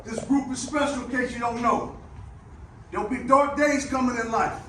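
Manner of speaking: loud rousing locker-room speech; halftime pep speech. Real, not acted. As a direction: fire up the team, rising to a shout. Building pep speech to players.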